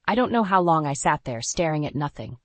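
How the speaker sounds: ordinary delivery, nothing marked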